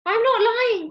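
high, loud protest; crying out. A narrator's reading. Ben protests to Mister Parker, crying out. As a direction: cry out in denial. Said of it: "cried Ben".

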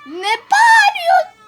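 high, loud, stretched-out question; drawn-out protesting yell; startled shout. Real, not acted. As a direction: yell back at the screamer. Reacting to game's scream.

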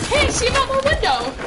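high, loud excited call; panicking gamer. Real, not acted. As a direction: yell it out in alarm. Spotting the enemy in game.

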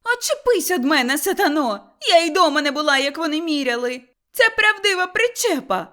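high, loud, angry protest; indignant outburst. Acted. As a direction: shout her off you, outraged. Mother-in-law fights back in quarrel. (обурений гучний крик)

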